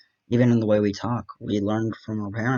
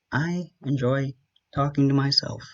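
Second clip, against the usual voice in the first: higher, proud declaration; owning it proudly; 5 semitones higher than usual. Shown as a demonstration. Vlogger owning that he talks to himself, proudly declaring it. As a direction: say it like a bold, unashamed announcement. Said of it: "I'm gonna own it".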